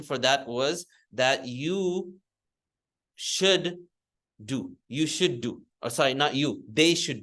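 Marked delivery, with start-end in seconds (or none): none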